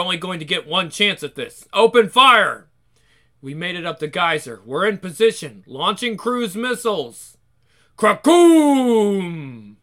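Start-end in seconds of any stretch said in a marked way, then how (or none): 1.7-2.7 battle cry at full volume; shouted command. Acted. 8.0-9.8 booming explosion noise; voiced blast sound.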